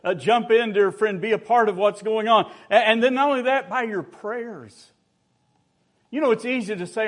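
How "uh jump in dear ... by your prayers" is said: loud, high preaching appeal; rally the congregation to join in; fervent preaching. Acted. Preacher urging the church to jump in, loud and fervent.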